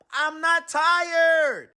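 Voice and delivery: high, loud, slow annoyed shout; irritated monkey shouting; grumpy shout. Acted. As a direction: snap back grumpily. Jim refusing, grumpy.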